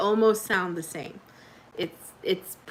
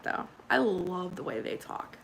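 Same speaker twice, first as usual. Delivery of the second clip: ordinary delivery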